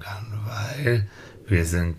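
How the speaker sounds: soft unvoiced whisper. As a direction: whisper the landing gently. Genie announces landing softly.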